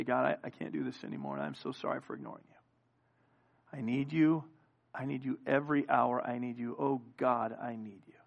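soft, fading plea to God; hushed broken prayer. Acted. Preacher voicing a broken believer, soft and fading. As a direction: pray it low, as if broken. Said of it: "fall before the Lord and say".

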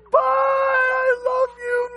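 slow, high, loud crying farewell; high wailing cry, loud and slow; bawling goodbye. Retold or imitated. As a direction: sob goodbye to the beloved car. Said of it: "I bawled".

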